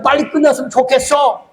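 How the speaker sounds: a light imitation of a bored member complaining; complaining churchgoer. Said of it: "그런 성도들 좀". (교인을 흉내 내며 높게 투덜대듯)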